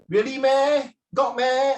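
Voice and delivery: high, loud, drawn-out Singlish question; Singlish demo. Shown as a demonstration. Teacher demonstrating Singlish particles to students, incredulous Singlish. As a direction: stretch the doubting Singlish "meh". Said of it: "all this is very Singlish".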